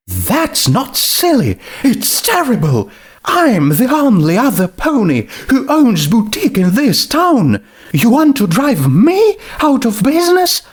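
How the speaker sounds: rising outrage. Acted.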